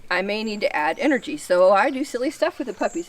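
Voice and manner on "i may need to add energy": ordinary talk, nothing marked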